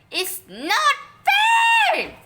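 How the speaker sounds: high, loud, slow tantrum scream. A narrator's reading. Boy screaming at Mom over unfairness. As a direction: wail it as a tantrum. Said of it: "he screamed".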